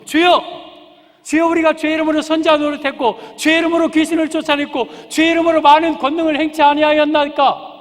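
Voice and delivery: loud, high desperate plea to the Lord; pleading cry. Acted. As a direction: cry out begging to be recognized. Many plead with Jesus. (주님께 매달리며 높고 크게 호소하듯)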